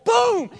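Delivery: a sudden shouted boom; loud mock bang. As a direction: shout a door-crash boom. Soldier bursting in.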